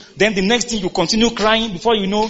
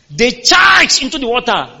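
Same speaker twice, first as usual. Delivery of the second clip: dramatic telling; 5 semitones higher, 6 dB louder than usual. A narrator's reading. Dramatic retelling of swine.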